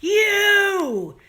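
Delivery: sudden high, loud yelled curse; mock scream. Runner at Boylston, imagined scream at the attackers, loud and high. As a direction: spit it out like a pent-up scream at the world. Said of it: "you just wanna scream".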